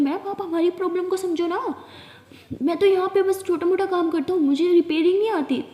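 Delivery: a lightly voiced helpless shop boy pleading; showroom worker.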